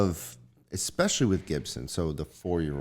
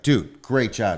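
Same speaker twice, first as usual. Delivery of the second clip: loud, higher-pitched dad praise; celebrating; 5 semitones higher, 7 dB louder, slower than usual. Acted. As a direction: hype up a kid who just finished. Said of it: "is celebrating it, like, afterwards".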